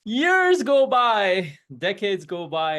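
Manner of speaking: drawn-out, high and loud storyteller sweep; dramatic storytelling. A narrator's reading. Narrator telling the parable, slow high dramatic sweep. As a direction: stretch the years out like a fairy tale.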